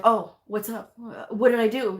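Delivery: worried provider. Retold or imitated. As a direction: play the caught-off-guard provider asking what they did. Provider startled by her visit.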